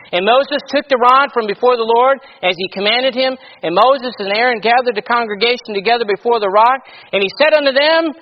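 loud, measured King James reading voice; scripture reading. Acted. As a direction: read the Bible passage out to the church with weight. Preacher reads scripture aloud.

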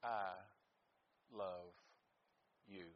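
soft, slow and tender, each word on its own; hushed, loving words to family; tender I love you. Shown as a demonstration. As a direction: say it softly, like you mean it to a grandchild. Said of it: "I want them to know".

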